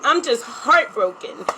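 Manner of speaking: holding back tears while speaking; tearful. Real, not acted. Telling her tribe about hurting people, near tears. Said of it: "I don't know why I'm so emotional".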